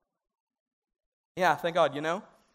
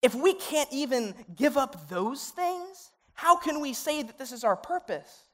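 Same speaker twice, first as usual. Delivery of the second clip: normal talking voice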